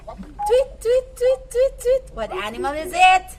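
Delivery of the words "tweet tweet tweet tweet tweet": high, chirpy tweets; bird chirping. Shown as a demonstration. Teacher chirps like a bird for the class.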